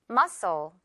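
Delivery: "Muscle" as MUH-sul, no k; "Muscle" in two syllables, the c silent; model pronunciation. Shown as a demonstration. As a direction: model "Muscle" slowly for repeating. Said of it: "듣고 따라해 주세요".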